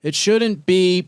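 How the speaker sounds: a janitor's panicked shout before the blast; alarmed cry. Acted. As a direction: cry it out as the floor goes. [name] cries out in alarm.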